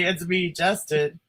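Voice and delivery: slow deadpan self-intro; drawn-out mock introduction; mock announcement. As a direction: announce the name slowly like it matters. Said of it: "So he has to say".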